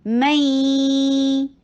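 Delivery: میں recited slowly, merged with a held nasal ghunna; nasal merging demo. Shown as a demonstration. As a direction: hum میں through the nose. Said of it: "یہ ادغام ہو رہا ہے بغنہ".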